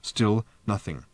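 plain reading voice

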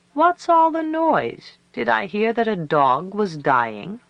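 high, loud, drawn-out opening, easing into a taunt; scornful calling down. A narrator's reading. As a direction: call down haughtily at a grieving rival. Old woman taunts grieving rival from porch.

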